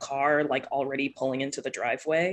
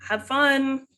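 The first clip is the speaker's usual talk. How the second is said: lightly acted parent, higher and louder; dad calling after; 10 semitones higher, 6 dB louder than usual. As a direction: call a send-off to your departing kid. Dad calls after departing daughter.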